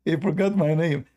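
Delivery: ordinary delivery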